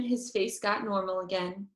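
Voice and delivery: ordinary delivery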